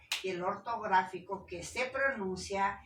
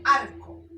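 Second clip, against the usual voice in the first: Arco with a loud, high first A; stress on A; 5 semitones higher, 12 dB louder than usual. Shown as a demonstration. Teacher giving an example, Arco stressed on A. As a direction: punch the A, drop co in Arco. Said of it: "la fuerza de voz en A".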